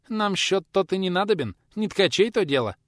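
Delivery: high-voiced little man of importance; self-important boy. A narrator's reading. Boy dismisses counting to the pensioner, self-important. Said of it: "важно отвечал паренёк".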